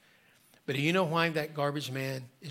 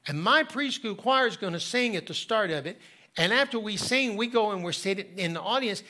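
put-on higher, eager little-boy voice; 6 semitones higher than usual. Preacher voicing boy inviting garbage man, eager child voice. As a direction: voice a bright five-year-old inviting him.

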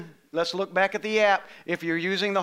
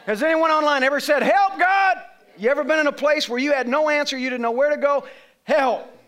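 preaching at full force; 5 semitones higher, 4 dB louder than usual. Acted. Pastor pressing the verse on his church, preaching loud. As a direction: lift the verse up to the congregation.

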